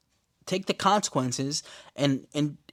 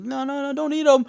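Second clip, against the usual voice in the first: much higher and louder, a desperate mock plea; panicked plea; 12 semitones higher, 5 dB louder than usual. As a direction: cry out to save the mouse. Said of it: "She's like".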